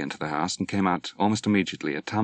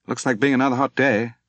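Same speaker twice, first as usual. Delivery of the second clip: much higher, louder, quick breezy offer; breezy invitation; 6 semitones higher, 5 dB louder than usual. A narrator's reading. Peter invites Dwight for a swim, bright and fast. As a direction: toss off a cheerful invitation.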